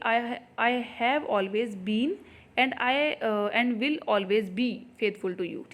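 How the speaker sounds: slow, word by word sentence reading; slow dictation read. Shown as a demonstration. Teacher reading the exam question to students, slowly. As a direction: spell the sentence out for the class.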